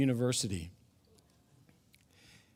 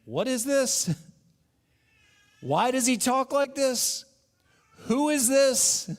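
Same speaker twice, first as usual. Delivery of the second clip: high, baffled crowd voice, slowing on the last question; mock the bewildered onlookers asking who this is; puzzled questioners; 10 semitones higher, 3 dB louder than usual. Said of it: "asking questions like".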